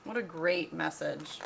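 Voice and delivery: ordinary talk, nothing marked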